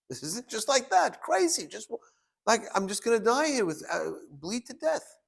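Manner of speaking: high-pitched, retold shock at dying; acted disbelief. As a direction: imitate a wounded man's stunned protest. Wounded soldier faces sudden death.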